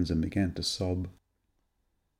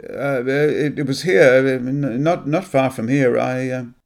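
broken, higher pitched stumbling; a caught liar grasping for words; struggling stammer; 4 semitones higher, 5 dB louder, slower than usual. Acted. Graham caught out by Anne, stammering nervously. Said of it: "Graham was struggling".

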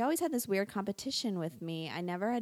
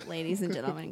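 normal talking voice

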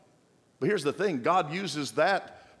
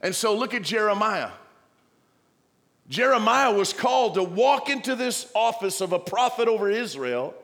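higher, louder preaching cry; full-force preaching; 7 semitones higher, 5 dB louder than usual. Acted. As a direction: preach it with rising fire.

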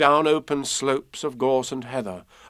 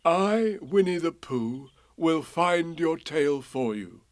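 higher, measured bear voice; solemn; 4 semitones higher, slower than usual. A narrator's reading. Pooh promising to find the tail, solemn. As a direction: make it a grave vow. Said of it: "he said solemnly".

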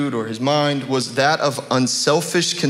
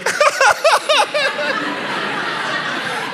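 very high, drawn-out mock laugh; hammed-up high-pitched cackle of amazement; long, high comic outburst, acting out a father's reaction; exaggerated overjoyed outburst. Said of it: "And I was like".

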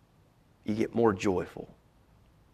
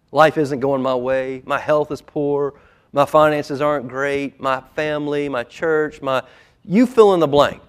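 loud, rising litany; building preaching; 6 semitones higher, 12 dB louder than usual. Acted. Preacher listing life's troubles for the congregation, building preaching. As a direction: pile up each trouble, louder and higher.

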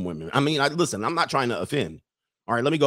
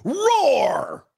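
high, drawn-out comic roar; exaggerated roar of release; mock roar; 13 semitones higher, 4 dB louder than usual. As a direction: let out a big put-on roar. Imitates man's roar of relief.

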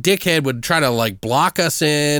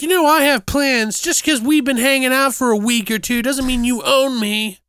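put-on much higher, huffy girl parody; pitched up and offended; mock indignant girlfriend; 10 semitones higher than usual. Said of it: "She was like".

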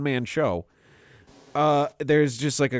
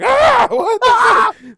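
terrified scream; 14 dB louder, much slower than usual. Retold or imitated. Scream at undead animal.

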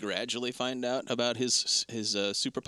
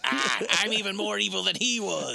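mock gloating villain; 6 semitones higher, 3 dB louder than usual. Voicing the chuckling villain.

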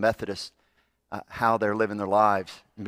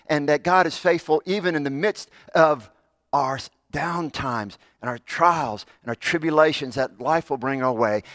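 louder, higher preaching push; fervent preaching; 6 semitones higher, 5 dB louder than usual. Acted. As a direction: drive the message home to the congregation. Preaching builds in force.

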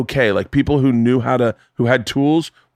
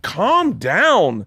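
retold condescending plea, pitched up and drawn out; patronizing; 6 semitones higher than usual. Wife to upset husband, patronizing. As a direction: draw it out as a put-down.

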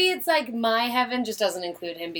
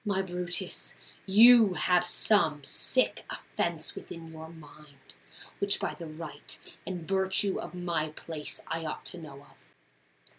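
much softer, slower plea; tender Portia; 4 semitones lower, 8 dB quieter, slower than usual. Acted. Portia softly begging Brutus to confide, quiet. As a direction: drop to an intimate plea. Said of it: "here's the scene".

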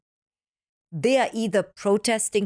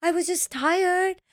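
put-on higher, slow, whiny teen; whiny daughter; 12 semitones higher than usual. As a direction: drag it out like an exasperated kid. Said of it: "She's like".

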